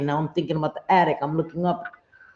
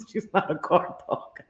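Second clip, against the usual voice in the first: breathy laughing repeat; 6 dB quieter than usual. Real, not acted. Laughing to her stream about her scaredy dog, whispering through giggles.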